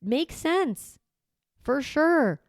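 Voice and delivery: high-pitched, wholehearted agreement; emphatic validation. Acted. Parent validating an upset child's feelings, emphatic agreement. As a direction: agree completely, pitch leaping up on each phrase. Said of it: "validate it. We're like".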